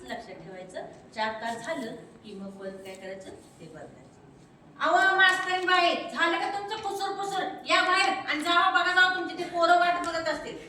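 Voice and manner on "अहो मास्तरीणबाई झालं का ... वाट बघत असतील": high, loud mocking old woman; grandmother calling out loudly. Grandma calls teacher out of the room, loud and mocking. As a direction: yell her out of the house.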